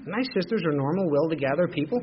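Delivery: usual speaking voice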